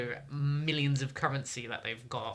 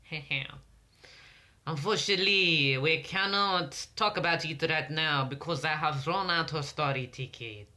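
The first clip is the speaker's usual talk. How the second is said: put-on French accent; 3 dB louder than usual. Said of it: "stop doing that accent".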